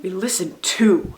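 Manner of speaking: "to" pushed out at the end; stress on TO. Shown as a demonstration. Teacher listing all three things to do, stress on "to". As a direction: land firmly on "to". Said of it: "all three of those things".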